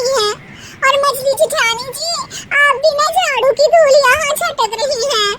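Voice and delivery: high, loud whiny complaint; youngest daughter-in-law. Acted. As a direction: scold the sisters-in-law for the dust.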